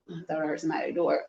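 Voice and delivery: hushed, rushed aside; quiet fast aside. Real, not acted. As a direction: mutter it quickly under your breath. Quick quiet aside mid-talk.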